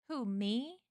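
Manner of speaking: innocent question with a rising end; feigned innocence. Acted. Man answers accusation of killing, innocently. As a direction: play the wrongly accused. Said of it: "he said innocently".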